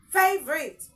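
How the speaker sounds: "favorite" loud and high as FAY-vrit; correct pronunciation. Shown as a demonstration. Teacher giving learners the correct word, "favorite" as loud FAY-vrit. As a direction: punch "favorite" with a short final "rit". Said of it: "It is correctly pronounced as".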